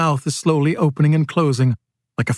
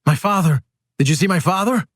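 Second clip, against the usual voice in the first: raised, urgent question; anxious asking; 5 semitones higher than usual. A narrator's reading. George turns to the rear asking after his father, anxious. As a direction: ask urgently, pitch climbing.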